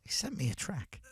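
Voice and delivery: hushed confidential whisper. Retold or imitated. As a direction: whisper it as a secret. Friend confiding about the album.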